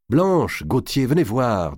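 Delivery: calling out. A narrator's reading. Calling friends to the window.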